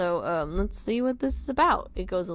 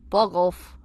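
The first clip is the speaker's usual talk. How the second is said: amateur Scouse; curt line in put-on Liverpool accent; bad Liverpudlian accent; 6 dB louder than usual. Nick replying to friends, bad Liverpudlian accent. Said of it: "my shit Liverpudlian accent".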